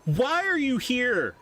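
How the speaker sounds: put-on high, slow, upset child voice; ghost child demanding. The ghost boy confronts Kai, high and upset. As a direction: press him like a furious little ghost.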